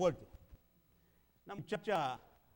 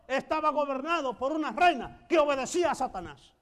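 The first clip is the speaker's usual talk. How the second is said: louder preaching, pushing every phrase; preaching at full force; 5 semitones higher, 12 dB louder than usual. Acted.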